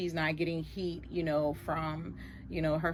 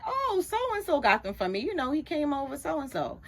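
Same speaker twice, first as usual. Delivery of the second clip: daughter's reply; 7 semitones higher than usual. Retold or imitated. Daughter answering her mother.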